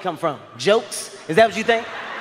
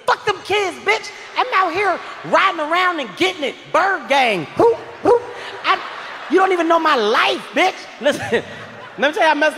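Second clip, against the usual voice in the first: hype drug dealer; 7 semitones higher than usual. Retold or imitated. High boyfriend to girlfriend, hyped-up dealer brag. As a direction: shout it like a dealer showing off his street life.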